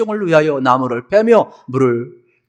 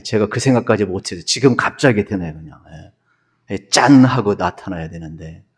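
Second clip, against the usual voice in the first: normal talking voice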